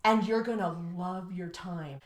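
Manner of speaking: ordinary delivery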